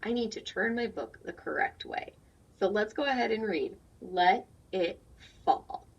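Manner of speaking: normal talking voice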